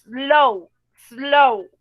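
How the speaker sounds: slow spoken loud and stretched in one breath; slow, one stroke of the voice; drawn-out slow. Shown as a demonstration. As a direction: say slow as one long syllable. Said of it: "un solo golpe de voz".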